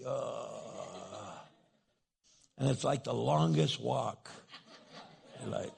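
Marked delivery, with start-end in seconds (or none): 0.0-1.3 low, soft, dragged-out groan; reluctant groan. Acted. Husband sent to tell his wife sorry, long reluctant groan. Husband told again to apologize.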